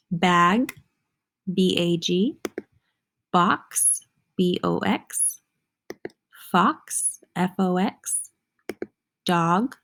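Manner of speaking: usual speaking voice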